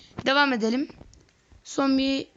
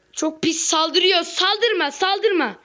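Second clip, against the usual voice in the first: alarmed protest; 6 semitones higher, 4 dB louder, slower than usual. Real, not acted. Frustrated at enemy attack.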